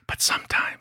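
sly whispered aside. Real, not acted. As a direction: drop to a whisper.

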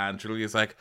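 ordinary talk

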